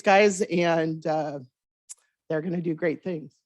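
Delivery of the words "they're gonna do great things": usual speaking voice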